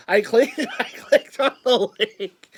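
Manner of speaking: laughing while telling it; giggling through the words. Real, not acted.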